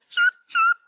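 tiny bird chirping, very high; high chick chirp. Acted. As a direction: peep it like a little chick. Chick answers in story.

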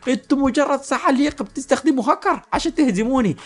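shrieky acted indignation; mock scream. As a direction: accuse them in hammed-up outrage. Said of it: "زعيم الشياطين صرخ فيه".

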